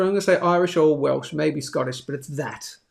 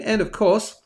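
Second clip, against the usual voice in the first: ordinary delivery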